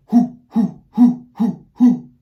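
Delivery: short, punchy hoots from the diaphragm, high and loud; Papa Owl hoots. Shown as a demonstration. Music teacher leads class in Papa Owl hoots, short punchy hoots. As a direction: hoot five times, sharp and strong like an owl. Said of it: "Let's do that five times in a row".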